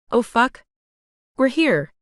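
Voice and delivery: exclaimed in mild surprise; exclaiming. A narrator's reading. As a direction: blurt it out, lightly startled. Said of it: "I exclaimed as I took my eyes off him".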